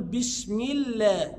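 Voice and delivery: slow "الله" with a thin, clear l; light lam. Shown as a demonstration. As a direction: keep the l of "الله" light after the i. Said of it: "إذا كانت مكسورة فأقول". (ترقيق لام "الله" في "بسم الله")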